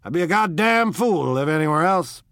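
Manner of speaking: high, booming, emphatic swagger; loud bluster. A narrator's reading. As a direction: bluster it out forcefully. Tom blustering about living East.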